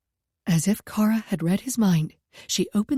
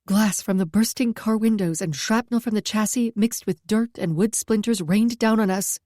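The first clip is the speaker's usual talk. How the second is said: ordinary delivery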